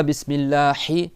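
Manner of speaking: BISMILLAHI with a scraped, rasping "hhi"; harsh wrong Ha. Shown as a demonstration. Teacher shows a wrong Ha, too harsh. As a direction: overdo the friction on the h of Bismillahi. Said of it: "c'est pas".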